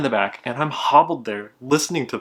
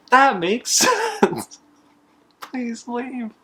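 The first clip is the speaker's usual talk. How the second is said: high voice breaking into a laugh; laughing through it; 4 dB louder, much slower than usual. Retold or imitated. The son telling the customer it makes sense, laughing, high. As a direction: giggle out the forced agreement.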